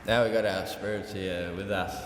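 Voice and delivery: normal talking voice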